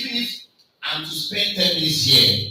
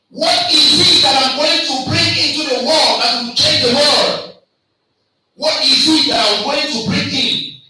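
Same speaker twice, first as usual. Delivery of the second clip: higher, louder inner question; imagined student's thought; 9 semitones higher, 8 dB louder than usual. Retold or imitated. Student asking himself, loud and high. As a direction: voice a student dreaming big. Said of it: "you think".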